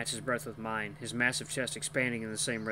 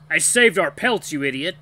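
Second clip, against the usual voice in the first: angry arguing; 4 semitones higher, 12 dB louder than usual. A narrator's reading. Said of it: "as the creature's arguing".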